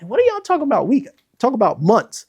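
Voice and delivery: high, fast disbelieving retort; high incredulous scoff. Retold or imitated. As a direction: scoff at weekly numbers, pitched high. Disbelief at weekly revenue talk.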